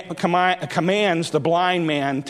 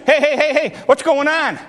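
higher, eager questioning; blind man asking; 5 semitones higher than usual. Acted. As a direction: urgently ask what the commotion is. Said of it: "he asks the question".

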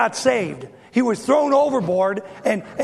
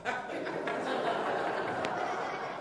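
laughter; 3 semitones higher, 13 dB quieter than usual. Real, not acted. Everyone laughing at dad's joke.

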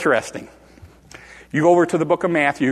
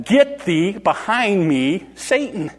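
very slow, weighted scriptural command; solemn rebuke; 4 semitones higher, slower than usual. Retold or imitated. Jesus turns away from Peter, slow solemn rebuke. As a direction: space out each phrase, ending higher on Satan. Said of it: "He turned away from him and he said".

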